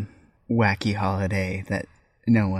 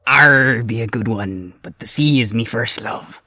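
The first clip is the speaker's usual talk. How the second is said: pirate voice; 7 dB louder than usual. Acted. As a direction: savour the joke like an old sea dog. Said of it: "Talk Like a Pirate Day".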